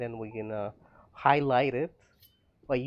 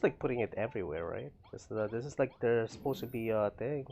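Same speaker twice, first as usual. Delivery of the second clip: ordinary talk, nothing marked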